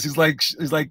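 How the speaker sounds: ordinary delivery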